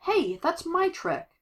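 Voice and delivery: high, drawn-out indignant call; indignant protest. Acted. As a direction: protest as if someone stole your move. Acted protest at monster copying her.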